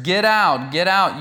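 loud cursing shout; hurled, furious get out; shouted curse. Acted. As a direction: bark it out like an angry man hurling curses. Shimei cursing David.

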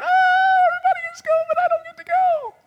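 hammed-up little girl bawling over the party; mock crying. Becky left out of the party, in a mock crying wail. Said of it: "And then Becky starts crying".

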